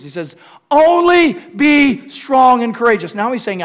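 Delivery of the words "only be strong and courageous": high, loud, slow charge; booming command. Acted. Preacher proclaiming God's charge to Joshua. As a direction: declare it slowly and loud.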